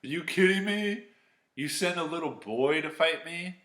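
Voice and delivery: Goliath scoffing in disbelief; mocking outraged giant; incredulous giant. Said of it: "He's like".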